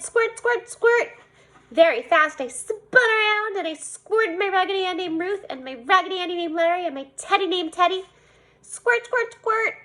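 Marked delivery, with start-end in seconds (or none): 0.0-1.1 high, punchy squirt squirt; spray the mirror with play sounds; child pretending to squirt herself; playful squirt noises. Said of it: "I said". 8.6-9.7 high, bouncy squirt squirt; child playing with a pretend seltzer bottle; playful squirt noises. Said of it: "I said again".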